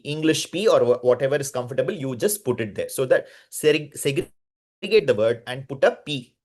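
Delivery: ordinary talk, nothing marked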